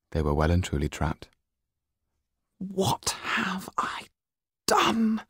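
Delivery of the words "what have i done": very high, slow, whispered dread; high whispered panic; frightened gasp. Acted. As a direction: breathe out the horror of the mistake. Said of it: "Yan could suddenly feel his fear".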